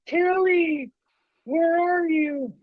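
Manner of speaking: high, drawn-out ghostly call; eerie sing-song calling of a name; spooky name call. Retold or imitated. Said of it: "that typical spooky, name calling thing".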